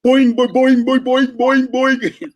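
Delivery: comic springy drum ring; ringing boing. Shown as a demonstration. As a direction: mimic a drum ringing like a tub. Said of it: "ringing like a big old tub".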